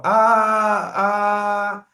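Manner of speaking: long, loud, level "A"; sustained aah on "A", twice; doctor's "aah". Shown as a demonstration. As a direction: open up and hold "A" like at the doctor's. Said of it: "o que o médico pede pra você fazer".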